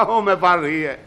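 chuckling through the words; laughing. Acted. Cameraman laughing at the director's question. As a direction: laugh it off at him.

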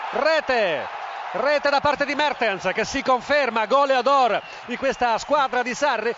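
excited goal call. Real, not acted. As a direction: proclaim the scorer with force. Goal call in live commentary.

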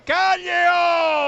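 high, loud, drawn-out name call; long goal shout. Real, not acted. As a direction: hold the name like a stadium roar. Goal call in live commentary.

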